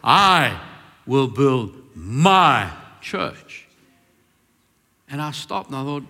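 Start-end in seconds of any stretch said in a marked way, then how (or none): 0.0-3.7 very slow, each word weighted, stress on my; emphatic. Retold or imitated. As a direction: let I will build my church land like a decree. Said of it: "He was quite emphatic".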